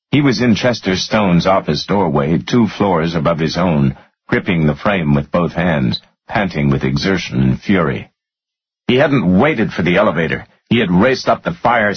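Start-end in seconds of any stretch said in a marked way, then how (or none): none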